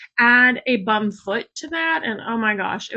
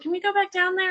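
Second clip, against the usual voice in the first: higher, lightly voiced little girl asking; imitating her daughter; 9 semitones higher, 3 dB quieter, faster than usual. Daughter asks mom to return to the beach, lightly mimicked hopeful child. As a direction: pitch up a bit like a hopeful child. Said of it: "And my daughter's like".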